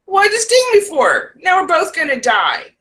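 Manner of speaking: stung frog's outcry. Retold or imitated. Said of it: "And he's like".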